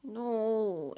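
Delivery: begging, a touch teary; pleading. Acted. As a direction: plead with her not to call. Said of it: "Amy pleaded".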